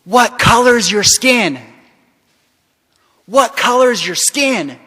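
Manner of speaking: high, loud, slow demand; screaming, imitated. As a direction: mimic a man screaming in someone's face. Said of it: "screams out".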